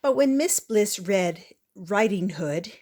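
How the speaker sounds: ordinary delivery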